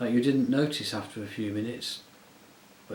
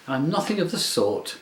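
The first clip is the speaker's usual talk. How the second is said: stamping indignation; 5 semitones higher, 4 dB louder than usual. A narrator's reading. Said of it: "stamping her foot".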